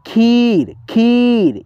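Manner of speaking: खीर with a long held ee; खीर said as kheeer; stretched long ee. Shown as a demonstration. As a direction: drag out the vowel in खीर. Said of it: "धीरा नहीं है".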